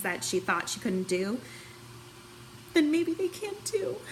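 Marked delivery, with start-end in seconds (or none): 2.7-4.0 fighting back real tears on the last words; choking up. Real, not acted. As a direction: voice breaking into tears, pitched up.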